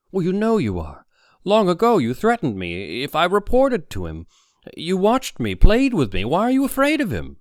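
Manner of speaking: high, loud, insistent challenge; Harry's accusation rising to a pitch; accusing confrontation. A narrator's reading. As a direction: press him hard, voice climbing.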